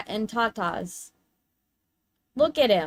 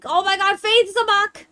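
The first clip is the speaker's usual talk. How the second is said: excited; 9 semitones higher, 6 dB louder than usual. Real, not acted. Excited at a friend's new look.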